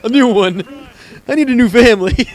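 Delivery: high, loud mock dismay; appalled kid. Sam hearing too much from parents, appalled. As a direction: play a horrified kid wanting out. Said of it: "And you're like".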